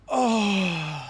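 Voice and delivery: long swooning "oh", falling away; mock delight. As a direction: sigh it out in hammed-up bliss. Acting Odin's delight after sniffing.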